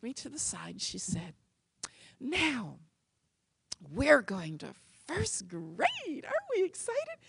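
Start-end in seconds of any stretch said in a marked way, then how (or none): 2.2-7.2 high, sing-song cheerful mom; bright coaxing mother to her little girl; cheery mom voice. Retold or imitated. Mother cheerfully coaxing her daughter before first grade, high sing-song.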